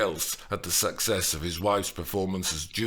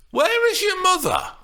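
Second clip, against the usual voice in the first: high, loud sudden demand; nurse's question; 8 dB louder than usual. A narrator's reading. Nurse suddenly asks Juliet about her mother. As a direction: snap the question out.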